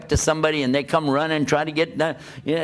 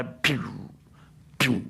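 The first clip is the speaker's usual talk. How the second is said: quiet imitated swish of a passing truck; soft mock whoosh; 2 semitones lower, 6 dB quieter than usual. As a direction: breathe out the sound of a truck flying by. Imitates truck rushing past.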